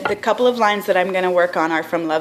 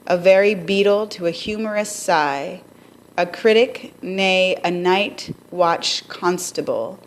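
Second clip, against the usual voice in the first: reading Shakespeare verse. Shown as a demonstration. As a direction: read the lines like a stage speech. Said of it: "So it says".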